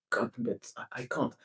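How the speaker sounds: breathless whisper of defeat; whispered despair. Retold or imitated. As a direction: whisper it like you can't bear it. Whispered exasperation.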